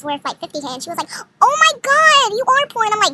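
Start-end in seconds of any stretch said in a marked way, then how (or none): 1.4-2.8 very high, loud mimicry of a snob; mock outrage. Arrogant girl belittles her flex battle rival, high loud mockery. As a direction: imitate her scornful shriek, pitched way up.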